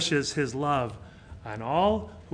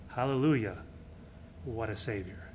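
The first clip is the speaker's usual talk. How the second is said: ordinary talk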